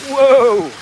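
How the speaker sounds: sudden high loud cry; startled whoa. Real, not acted. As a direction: caught off guard by speed. Speeding down the fast part.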